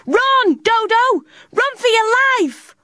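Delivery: very high, desperate shout; shouting hard. A narrator's reading. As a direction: scream a warning across the beach. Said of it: "shouted as hard as she could".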